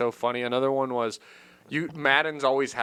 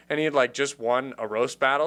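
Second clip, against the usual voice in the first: normal talking voice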